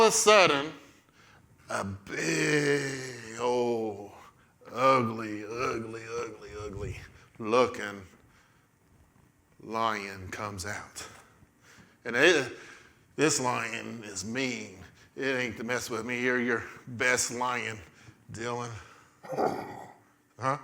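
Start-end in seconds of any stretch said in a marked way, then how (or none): 1.7-11.1 hushed, low and slow with long pauses; ominous storytelling. A narrator's reading. Storyteller announcing the ugly lion, ominous hush. 12.0-18.7 hushed warning about a dangerous beast; menacing storytelling. A narrator's reading. Storyteller introducing a mean lion, quietly menacing.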